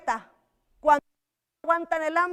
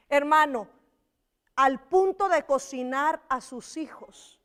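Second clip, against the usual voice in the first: ordinary talk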